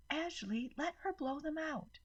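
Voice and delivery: high, strident yell of a mother; annoyed mother shouting across the room; Rose yelling. A narrator's reading. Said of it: "Rose yelled".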